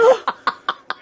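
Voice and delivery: startled high gasp; sharp shocked gasp. Acted. Carlos caught by Henrietta, gasping. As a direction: catch the breath in shock.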